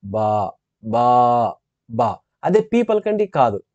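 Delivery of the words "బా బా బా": బా voiced and buzzing, lower in the throat. Shown as a demonstration. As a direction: let బా vibrate in the voice box. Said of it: "అది vibrate అవుతుంది". (బా ను గొంతులో కంపనతో, లోతుగా పలకడం)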